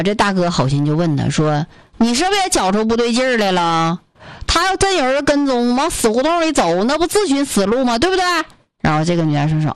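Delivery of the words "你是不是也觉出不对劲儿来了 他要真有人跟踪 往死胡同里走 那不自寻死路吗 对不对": put-on higher, kindly Northeast accent. Northeast brother explains the trick to the student, in Northeast accent. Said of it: "操着一口这个东北口音的大哥".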